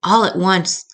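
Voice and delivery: plain reading voice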